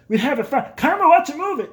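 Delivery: high, insistent father caricature; imitated dad. Dad calling his child to a war movie, high and insistent. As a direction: urge it in an excitable mock-dad voice. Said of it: "My dad, like".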